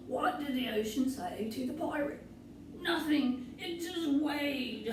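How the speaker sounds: milk the pun like a jolly pirate; pirate joke telling. Acted. Pirate telling a silly joke to the kids, lightly hammed. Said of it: "silly pirate jokes, like this".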